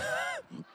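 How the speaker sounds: sudden squeaky laugh; high burst of laughter. Real, not acted. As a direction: let a high giggle escape. Laughs at crumbs in bed.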